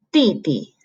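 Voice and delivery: D D as "dì-di", first falling sharply, second light; fourth tone, light tone. Shown as a demonstration. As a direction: Mandarin "dì-di", stress on the falling first syllable. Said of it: "the tone has to go down".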